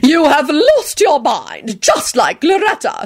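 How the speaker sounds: high, drawn-out haughty put-down; lofty grande dame; supercilious. A narrator's reading. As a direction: look down your nose at her. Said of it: "she declared superciliously".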